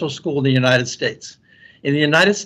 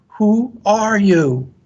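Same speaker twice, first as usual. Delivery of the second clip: high, slow echo of a yell; echoed answer; 7 semitones higher, much slower than usual. A narrator's reading. Mountain voice answering the son, echoed call. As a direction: call it back stretched out, like an echo. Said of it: "He receives the answer".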